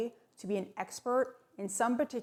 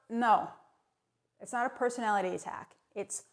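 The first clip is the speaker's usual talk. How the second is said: ordinary talk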